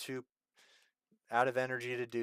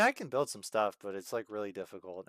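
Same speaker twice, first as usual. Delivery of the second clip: usual speaking voice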